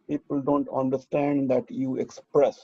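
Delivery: ordinary talk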